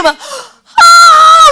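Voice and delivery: fan scream. Pretend. Preacher acting a fan screaming at an idol, long loud high shriek. Imitating screaming fans at concert.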